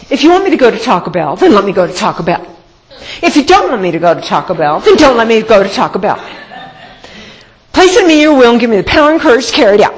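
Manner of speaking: rushed, bratty prayer; snotty attitude. Retold or imitated. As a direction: pray fast with real snotty attitude. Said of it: "a real, real snotty attitude like that".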